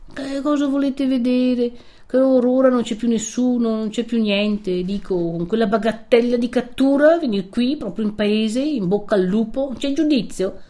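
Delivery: high-pitched, fretful remonstrance; worked-up protest, pitched well up; alarmed scolding. Acted. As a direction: scold the reckless youngster in rising alarm. Alarmed priest scolds the returned fugitive.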